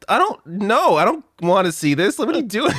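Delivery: high, rising to a yell; brushing off mom's texts, mock exasperated; exasperated retort. Retold reply to her mom.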